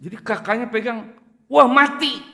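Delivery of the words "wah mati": panicked cry. Retold or imitated. Sister finds no breath.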